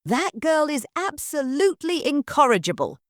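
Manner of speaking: seething mistress keeping it in; through gritted teeth. Acted. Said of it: "said through gritted teeth".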